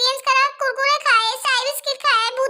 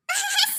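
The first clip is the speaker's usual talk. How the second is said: soft giggle; 5 semitones higher, 5 dB quieter than usual. Acted. Character asked why laughing.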